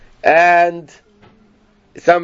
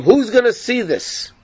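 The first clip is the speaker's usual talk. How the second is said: mocking taunt; 6 semitones higher than usual. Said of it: "And he starts laughing at him".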